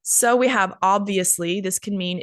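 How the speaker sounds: ordinary talk, nothing marked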